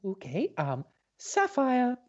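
high, squeaky character voice; high caller voice. Acted. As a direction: play a silly caller. Plays caller Monotony.

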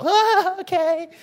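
put-on high, eager little boy; little boy's voice. Brother answering dad, high child voice. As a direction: pitch way up like a third grader.

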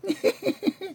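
high, soft, tittering giggle; schoolgirl giggle. A narrator's reading. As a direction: titter girlishly. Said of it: "She giggled like a schoolgirl".

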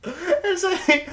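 high burst of laughter; can't stop laughing. Real, not acted.

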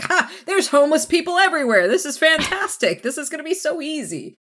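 high, fast, delighted cackling glee; gleeful villain glee. Retold or imitated. Crick gloating to Morgana about easy victims, gleeful. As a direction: relish the easy prey, quick and high.

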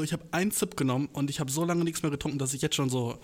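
ordinary talk, nothing marked

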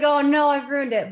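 loud, high cry of dismay; retold dismay. Storyteller replays realizing she spoiled his proposal, loud retold dismay. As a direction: exclaim it as if you just spoiled the surprise.

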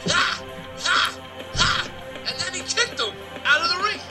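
normal talking voice

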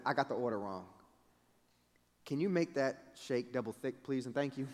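low, hushed polite request; sheepish request. Retold or imitated. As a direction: ask softly, owning the mistake. Retold sheepish correction of order.

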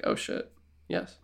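low, hushed, slow mock awe; awed acceptance. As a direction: drop the voice as if stunned by the offer. Acts studio offered a Bond trilogy.

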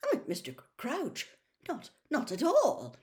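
high, stammered, flustered denial; horrified backtracking. A narrator's reading. Amos Diggory denying he accused Mister Crouch, horrified. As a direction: back down hastily, horrified. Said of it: "Amos Diggory looked horrified".

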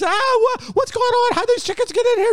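mother finding chickens in her house, shrieking at Duke; shocked mother. Acted. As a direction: scold the boy in a panic. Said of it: "And she's".

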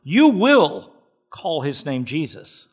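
preacher pressing "You will" with weight; stress on You will. Shown as a demonstration. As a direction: hit "You will" hard, then let the name land. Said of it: "I want to emphasize the first two words".

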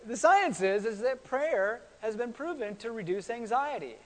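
ordinary talk

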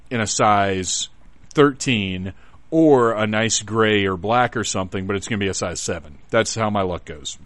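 ordinary delivery

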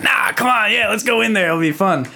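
high-pitched, light-hearted coaxing; eager urging. Retold or imitated. Friends coax a hesitant girl into going inside, eager urging.

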